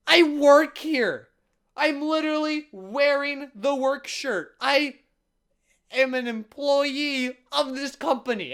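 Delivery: high, loud, slow insistence; hysterical protest. Retold or imitated. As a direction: protest it word by word, at full pitch. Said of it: "I get a little hysterical".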